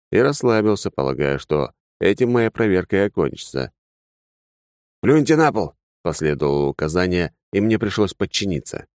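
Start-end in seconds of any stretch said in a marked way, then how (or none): 5.0-5.8 masked soldier order. Acted.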